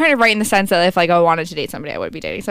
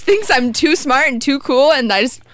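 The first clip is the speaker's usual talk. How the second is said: smug mock boasting, pitched up; sarcastic; 7 semitones higher than usual. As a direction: brag with heavy irony.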